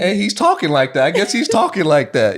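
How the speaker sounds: high-pitched, amused concession; high and giddy with laughter; laughing remark. Retold or imitated. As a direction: crack up while retelling it, voice way up. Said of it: "I'm laughing, I'm like".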